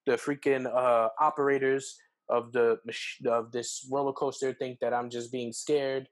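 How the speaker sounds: ordinary talk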